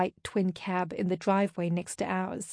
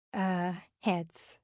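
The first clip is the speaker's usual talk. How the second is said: soft, hesitant, distracted; absently; 5 dB quieter than usual. A narrator's reading. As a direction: trail it out with your mind elsewhere. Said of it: "I said absently".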